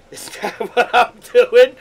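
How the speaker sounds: laughing loud at own mistake. Real, not acted. Amused at his own fake French, laughing. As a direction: burst out laughing at yourself.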